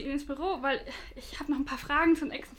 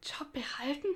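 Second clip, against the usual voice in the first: shocked whisper; 8 dB quieter than usual. Acted. Shocked by threat to her job.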